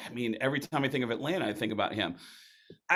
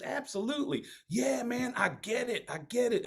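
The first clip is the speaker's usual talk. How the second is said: higher, soft, agreeable imitation; Southside voice; 11 semitones higher, 5 dB quieter than usual. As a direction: nod along with every word. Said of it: "He goes".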